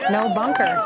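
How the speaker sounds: "No bunker." squeaked way up high with a rising tune; playful high-pitched imitation of a player; mock high quote. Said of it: "he said it himself".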